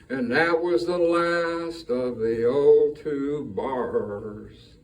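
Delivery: slow, drawn-out closing verse; slowed-down song. Shown as a demonstration. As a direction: ease the song down to its end. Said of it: "the pace of the song would slow down".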